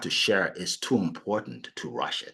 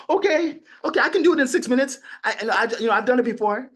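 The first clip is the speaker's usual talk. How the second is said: much higher, fast, eager-to-please voice; mock a desperate salesperson caving in; 12 semitones higher, 5 dB louder than usual. Mocking salespeople who agree to rush the pitch. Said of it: "Salespeople are like".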